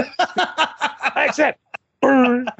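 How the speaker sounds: slow, high, laughing through the words; giggling fake gravitas; laughing mock acceptance. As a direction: accept the duel while cracking up.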